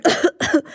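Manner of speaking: short breathy cough; boy coughing on fairy dust; dusty cough. A narrator's reading.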